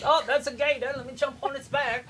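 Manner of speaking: high, loud, fast hammed-up Aussie; over-the-top Aussie parody; mock Australian accent. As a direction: bellow a cartoon Aussie cliché. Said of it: "Talk like that".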